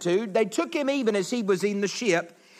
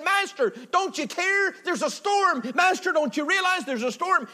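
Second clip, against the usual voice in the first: much higher, urgent pleading cry; panicked plea; 7 semitones higher than usual. Acted. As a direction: beg the master in a panic. Disciples panicking in the storm.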